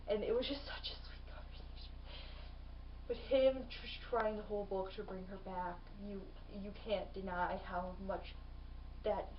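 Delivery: soft, unvoiced, tender hush; hushed and moved. Real, not acted. Describing the siblings' goodbye to viewers, hushed. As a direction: let the emotion quiet you down.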